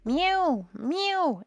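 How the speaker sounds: high, loud cat mew. A narrator's reading. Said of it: "interrupted Simpkin".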